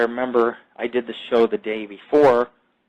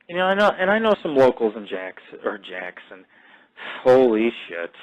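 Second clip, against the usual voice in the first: normal talking voice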